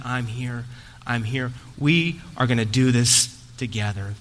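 low, softened words of comfort; reassuring. Retold or imitated. Father to son on the track, quiet low reassurance.

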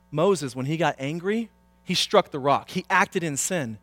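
ordinary talk

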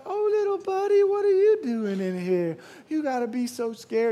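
put-on high-pitched baby talk to a tiny creature; imitated cooing. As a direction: mimic someone sweetly fussing over a spider. Imitating Amanda cooing to the spider.

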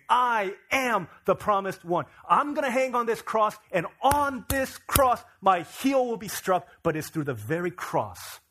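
loud, high, slow proclamation as Jesus; preacher at full force voicing a bold claim; unequivocal declaration. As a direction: declare it undeniably, hammering each phrase slowly. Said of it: "He is unequivocally, undeniably saying".

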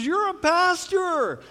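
high, loud, slow mock neighbor; neighbor's retort. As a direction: play the neighbor scolding him, high and drawn out. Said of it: "and she goes".

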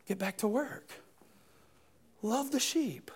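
breathy whisper, very soft; soft whispered charge. Acted. Jesus whispering his charge to Peter. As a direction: hush it like a secret instruction.

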